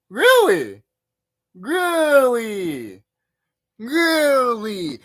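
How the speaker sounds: milk the disbelief; mock incredulous. Reacting to the clown video, hammed-up disbelief.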